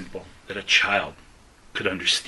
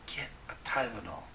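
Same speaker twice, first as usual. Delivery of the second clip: near-whispered advice from someone without a voice; barely audible, much softer; hushed, strained whisper; 12 dB quieter than usual. Retold or imitated. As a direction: slip it out very softly. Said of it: "I had to whisper it".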